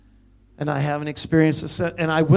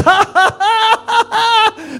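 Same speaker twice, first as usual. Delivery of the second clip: very high, loud acted laughter; exultant laugh; 10 dB louder, much slower than usual. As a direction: laugh out triumphantly at the top of your voice. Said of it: "I just danced and laughed".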